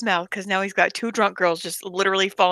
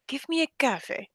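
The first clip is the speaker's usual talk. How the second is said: imitated Jordanian accent, higher-pitched; coffee turned into "cafe" in an Arabic accent; mock Arabic accent asking for coffee; strong Arabic accent; 5 semitones higher, 5 dB quieter than usual. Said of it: "somebody with a strong accent".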